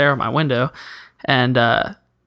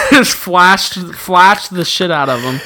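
laughing; 3 semitones higher, 5 dB louder, slower than usual. Real, not acted. Laughing at his own story.

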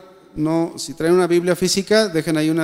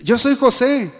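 raised, higher-pitched declaration; acted revelation; 5 semitones higher, 3 dB louder than usual. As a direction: announce who you are to stunned listeners. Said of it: "Empezó a, a hablar".

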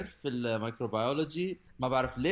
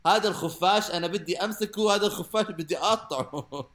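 playful threat, raised voice; mock angry; 6 semitones higher, 5 dB louder than usual. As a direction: fume at the bat in jest. Joking threat against the bat.

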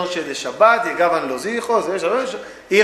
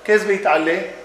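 ordinary talk

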